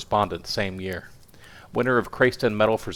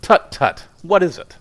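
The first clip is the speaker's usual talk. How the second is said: high, loud alarmed tut-tutting; 10 semitones higher, 8 dB louder than usual. A narrator's reading. Editor to young reporter asking a favor, alarmed. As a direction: react with alarm, pitched up and loud. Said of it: "He looked alarmed".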